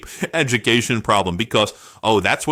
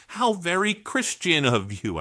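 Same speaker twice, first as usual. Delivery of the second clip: sarcastic; 6 semitones higher, 4 dB quieter than usual. Real, not acted. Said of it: "I'm being sarcastic".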